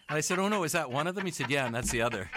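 high, loud, laughing through the words; amused anecdote, voice lifted by laughter; laughing retelling. Real, not acted. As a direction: tell the punchline while cracking up. Laughing while retelling Robert's joke.

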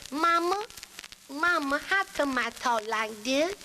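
high, put-on small child's voice; little girl voice. Little girl asking her mother, child voice. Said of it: "about the little girl who walked up to her mother".

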